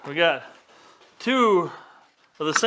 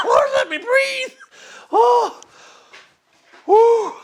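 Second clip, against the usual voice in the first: high-pitched laughing, gasping words; can barely talk for laughing; laughing hard. Real, not acted. Helpless laughing fit at own joke.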